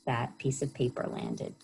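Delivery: ordinary delivery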